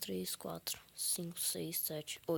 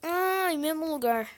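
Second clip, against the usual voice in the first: exasperated yell; 7 semitones higher, 9 dB louder than usual. Real, not acted. Falls again at the same spot.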